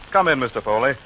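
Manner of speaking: ordinary talk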